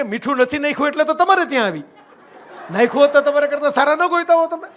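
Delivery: retold wife snapping back at her husband, hammed up; wife's sharp comeback. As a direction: fire back at the husband, half laughing. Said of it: "અને એ કહે કે".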